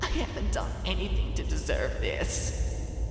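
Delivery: high, breathy tearful wail; a hopeless cry of injustice; high, half-whispered wail. A narrator's reading. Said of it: "Twilight wailed".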